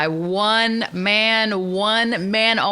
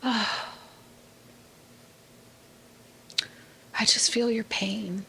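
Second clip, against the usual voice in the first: soft prophetic whisper; 5 semitones higher, 6 dB quieter, slower than usual. Retold or imitated. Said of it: "and she's like".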